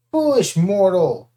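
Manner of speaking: loud, slow giant roar; bellowed. Acted. Cyclops rises to crush Percy, loud slow bellow. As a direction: boom it down at him. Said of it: "he bellowed".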